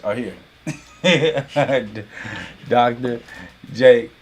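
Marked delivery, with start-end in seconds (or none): 1.0-2.6 breaking into a chuckle; amused laugh. Real, not acted. Host announcing himself to the cartel, amused chuckle. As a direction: let a real laugh slip out mid-announcement.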